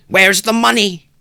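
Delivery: high, loud, slow demanding shout; frantic cry. A narrator's reading. Wife demands the money from husband, high frantic shout. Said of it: "she cried".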